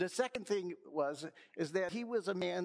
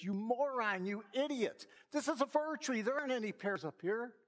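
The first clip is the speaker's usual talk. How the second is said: exasperated scolding; 6 semitones higher than usual. Retold or imitated. Squirrel scolding the elephant in a tree, high exasperated voice.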